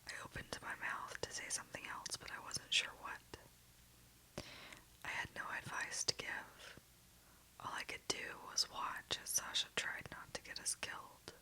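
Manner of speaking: ordinary delivery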